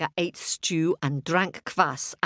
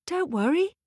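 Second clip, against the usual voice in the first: high, small doll voice; a tiny doll comforting her; little doll; 12 semitones higher than usual. A narrator's reading. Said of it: "said her little doll".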